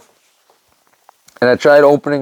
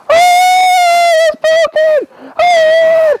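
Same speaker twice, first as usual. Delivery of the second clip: very high, slow, wailing mock scream; retold child scream; much slower than usual. Daughter screaming about her arm, imitated high wail. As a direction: wail it like a hurt kid in a panic. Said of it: "started screaming".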